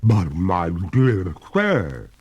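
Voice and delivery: slow, pleading ghost lady; drawn-out reluctant phantom voice. A narrator's reading. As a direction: hold back, unwilling to go. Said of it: "returned the ghost with a pale reluctance".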